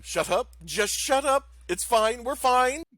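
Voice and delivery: nervous NPC telling the crew to shut up; panicked pilot hushing crew. Acted. Pilot Nock frantically hushing the crew.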